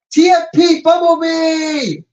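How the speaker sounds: announcer shout; excited shout. Real, not acted. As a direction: call out the name proudly. Announcing the backpack.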